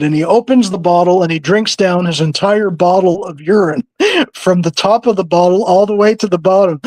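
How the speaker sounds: ordinary delivery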